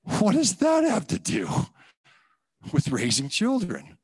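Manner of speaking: baffled, high and drawn out; incredulous. Retold or imitated. To God about tithing, stunned and incredulous. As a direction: pitch jumps way up in disbelief, slow. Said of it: "I'm like".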